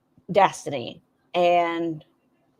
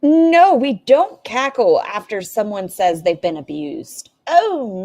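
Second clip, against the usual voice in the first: indignant scolding; 6 dB louder, slower than usual. Real, not acted. Reactor scolding someone who cackled about abuse, indignant. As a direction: come in hard and shut it down.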